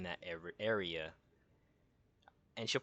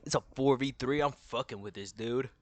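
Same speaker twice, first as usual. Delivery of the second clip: lightly voiced fighter, a bit louder; Maga; 2 semitones higher, 6 dB louder than usual. Streamer redoing the line as Maga, lightly put-on voice. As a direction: push it out as a slight character impression. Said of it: "I'll put the Maga voice too".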